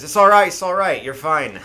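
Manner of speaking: hammy Scottish-Irish reassurance; Scottish-Irish accent. Acted. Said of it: "like, Scottish, Irish, that was weird".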